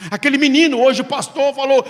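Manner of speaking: ordinary talk, nothing marked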